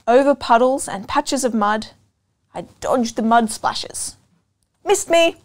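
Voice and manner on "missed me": mock shout. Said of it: "I shouted".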